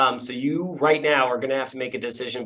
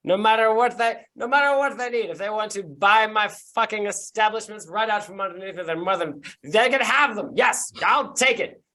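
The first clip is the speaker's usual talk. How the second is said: hammy character voice; 7 semitones higher, 4 dB louder than usual. Acted. GM voices drunk noble.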